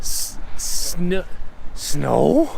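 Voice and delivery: slow, high, loud disbelief; stunned, drawing out each question; surprised. Real, not acted. Surprised by snow after landing.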